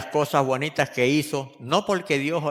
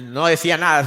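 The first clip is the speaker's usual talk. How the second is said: usual speaking voice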